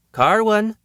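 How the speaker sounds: high call to a partner. A narrator's reading. Woman to her mate during the fight, calling out. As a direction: call the name out for help.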